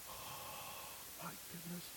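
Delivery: breathless hushed awe; awed whisper. Real, not acted. Preacher recalling first sight of his date, awed whisper. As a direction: barely voice it, struck by her.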